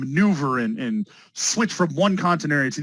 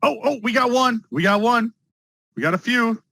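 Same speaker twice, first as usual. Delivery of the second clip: excited; 7 semitones higher than usual. Real, not acted. Student finally answers.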